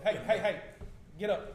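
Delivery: pressing wake-up call; urgent. Acted. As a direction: rouse a sleeper urgently. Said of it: "a little bit of urgency".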